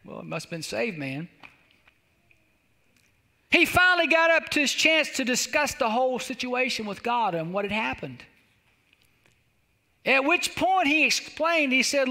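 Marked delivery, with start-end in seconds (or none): none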